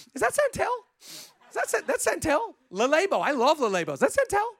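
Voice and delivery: high-pitched mock gushing over a scent; nosy perfume admirer. Family greeting the cologne-covered smoker, high mock gushing. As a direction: coo over the fragrance in a put-on high voice. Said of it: "They walk back in the house, you're like".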